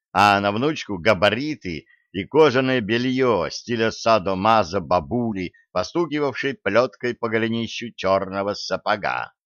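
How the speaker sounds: ordinary delivery, nothing marked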